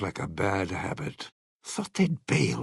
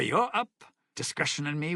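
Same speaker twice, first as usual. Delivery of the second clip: ordinary talk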